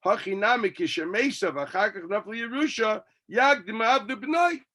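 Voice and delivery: usual speaking voice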